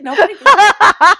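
laughing through the words, loud. Real, not acted. Book blogger laughing to her co-host that nobody gets her jokes. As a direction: laugh it out in disbelief.

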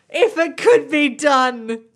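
drawn-out, belted-out mock longing; dramatic exclamation. Real, not acted. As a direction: proclaim it with comic grandeur. Mock-longing exclamation about a dream accent.

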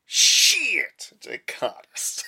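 high cry, then a whisper; yelp, then whisper in panic; caught-out panic. Pretend. Acting caught out.

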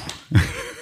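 soft unvoiced laugh under the breath; breathy laugh. Real, not acted. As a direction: laugh quietly, almost only air. Nervous joke about relaxing. (rire soufflé et discret)

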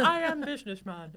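laughing through a put-on businessman voice; a hammed-up stiff businessman, giggling; mock businessman. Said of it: "Hoarder, hoarder, hoarder".

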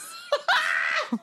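high squeal of laughter; real laughter. Real, not acted. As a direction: burst out laughing, high-pitched. Laughing at the insult.

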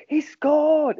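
retelling the commentator's goal call with a high, loud shout. Retold or imitated. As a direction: belt it like a commentator when the goal goes in. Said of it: "And he goes".

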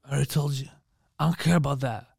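low, quiet, mopey mumble; a sulky brooding hero, barely bothering; mopey Batman. Retold or imitated. Friend mocking the mopey Batman in a low, quiet mumble. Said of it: "he's like".

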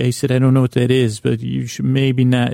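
normal talking voice

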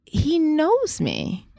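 high, slow, voice close to breaking; real hurt welling up as she speaks; emotional, slowed and strained; getting emotional. Real, not acted. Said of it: "I'm getting emotional right now".

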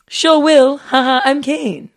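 high, loud chirpy reply; imitating her friend. Host reads co-host's keen reply aloud, loudly imitating her. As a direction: sing out the keen texter's answer. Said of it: "I'm doing you in like".

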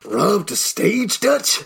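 high, slow hammed-up game voice; game character impression. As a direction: voice the shocked outlaw slowly. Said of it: "J J is the impressions guy".

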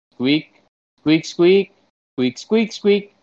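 high squeaks climbing step by step; squirrel talk. Pretend. Squirrel interviewed about its ideal world, squirrel talk. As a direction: squeak in rising squirrel phrases.

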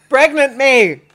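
high, loud, drawn-out mock demand; mock shout. Friend mocks the odd phrase, hammed-up shout. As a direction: bellow it slowly for a laugh.

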